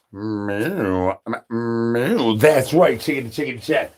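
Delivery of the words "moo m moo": lower, softer cow moo; a lightly voiced bull mooing; mock cow moo. Bull answers the call.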